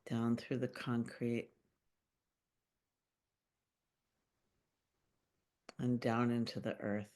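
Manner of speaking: calm meditation voice with long pauses; unhurried, gently guiding listeners into their bodies; guided meditation. Acted. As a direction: lead a grounding visualization, phrase by phrase, leaving long silences. Leads a grounding meditation.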